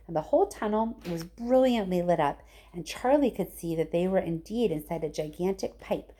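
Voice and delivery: ordinary delivery, nothing marked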